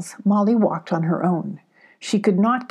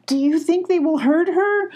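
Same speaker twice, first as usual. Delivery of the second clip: high and close to tears; tearful; 10 semitones higher than usual. Acted. Abby afraid for Molly, near tears. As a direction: plead through rising tears. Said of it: "Abby started to cry again".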